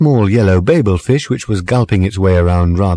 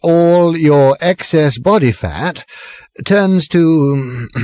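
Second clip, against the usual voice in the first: put-on slow, slurred, higher drunken voice; drunken rambling; 8 semitones higher, much slower than usual. A narrator's reading. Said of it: "pressing onward".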